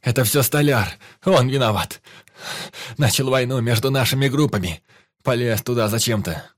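high, loud pained voice; pained pleading. A narrator's reading. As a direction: blurt it out, hurting. Wounded man keeps talking.

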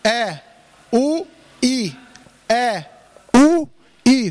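separate, punched glottal onsets on آ، أو، إي; clear hamza. Shown as a demonstration. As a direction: attack each vowel of آ، أو، إي with a firm hamza. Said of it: "خد كده حرف الهمزة".

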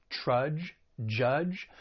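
"trudge" and "judge" said alike, both ending in a J sound; rhyming pair. Shown as a demonstration. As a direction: make "trudge" rhyme with "judge". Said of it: "These are rhyming words".

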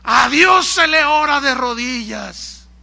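loud, slow, thundered rebuke; angry father. Retold or imitated. Father forcing his son to kneel in prayer, angry bellow. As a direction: bellow it as a drawn-out decree. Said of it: "con su cara de enojo".

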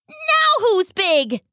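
high, slow, smug little wolf. Acted. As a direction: crow it to yourself, puffed up. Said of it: "he said to himself".

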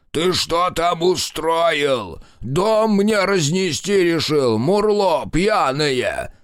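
put-on high, loud old man yelling upstairs; grumpy old grandfather. A narrator's reading. Grandfather yelling at grandson from below, loud. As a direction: bellow up the stairs at the fool grandson.

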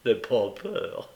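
slow, amused reassurance; drawn-out, lightly amused reply. A narrator's reading. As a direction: answer with a slow chuckle in the voice. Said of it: "répondit le juif en riant aussi".

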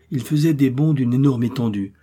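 plain reading voice

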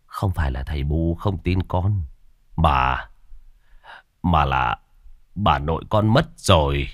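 halting, sorrowful news-breaking; heavy-hearted father, faltering; grieving father. A narrator's reading. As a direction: break a death gently, pausing between phrases.

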